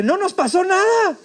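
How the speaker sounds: high, drawn-out cry; amazed exclamation. Retold or imitated. Friend Pedro arrives after the crash, astonished cry.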